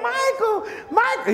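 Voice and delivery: very high imitated fan cry; hammed-up screaming fan; mock fan calling out. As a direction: squeal the name like a fainting fan. Fans calling out at concert.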